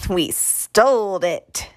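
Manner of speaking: put-on drawn-out blurt in a kid's voice; slow, boastful little-boy blurt. A narrator's reading. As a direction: blurt it proudly, stretching the words. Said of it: "Screecher blurted".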